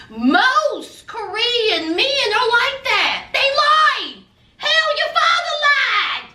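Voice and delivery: starts slow and lifted, builds to a high shout at the end; a mother reassuring her son, then shouting about his father; mother's rising outburst. Retold or imitated. Mother's scold, building to a high shout. Mama June's voice continues, shouting.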